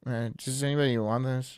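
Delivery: droning, sedated auctioneer; bored auctioneer voice. Pretend. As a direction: sound drugged and uninterested. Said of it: "I'd just be like".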